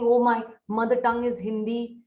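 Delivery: usual speaking voice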